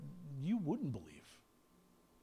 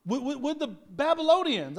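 louder insistent; 5 semitones higher, 4 dB louder than usual. Acted. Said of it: "repeated louder".

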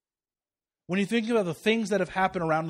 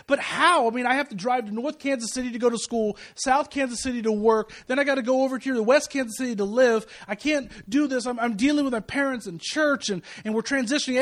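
higher, pressured pleading; overwhelmed protest; 6 semitones higher, 4 dB louder than usual. Retold or imitated. Young father protesting to God, overwhelmed. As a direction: pile up the worries in retold exasperation.

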